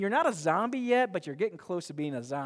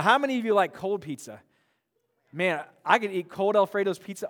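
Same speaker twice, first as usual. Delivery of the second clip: ordinary delivery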